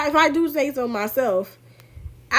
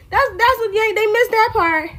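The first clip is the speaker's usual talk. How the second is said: indignant; 10 semitones higher, 4 dB louder than usual. Real, not acted. Indignant aside.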